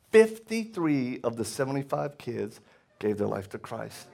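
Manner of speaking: ordinary delivery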